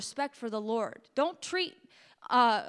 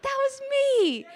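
much higher, louder, slow and breaking with emotion; tearful, overcome high break; voice cracking; 13 semitones higher, 5 dB louder, slower than usual. Real, not acted. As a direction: let the voice crack as it rises. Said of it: "my voice still cracks".

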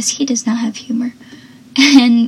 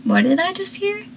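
confused; 3 semitones higher, faster than usual. Retold or imitated. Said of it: "looks confused and says".

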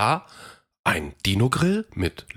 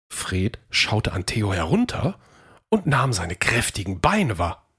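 usual speaking voice

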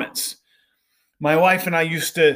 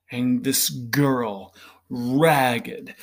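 ordinary talk

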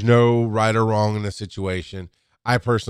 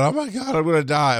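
imitating her panic, voice jumping much higher; mock panic; 6 semitones higher than usual. Retelling a fifty-ish patient panicking over an ankle, mock panic. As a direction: squeal it like a patient sure she's dying. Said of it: "she's freaking out".

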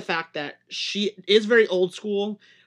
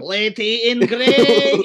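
slow, loud "Lady in gray" in a hammed-up Russian accent; mock Russian accent; 5 semitones higher, 11 dB louder, much slower than usual. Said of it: "Do it in a Russian accent".

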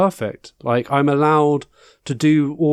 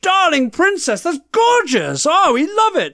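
gushing fans; 11 semitones higher than usual. Retold or imitated. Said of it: "and they went".

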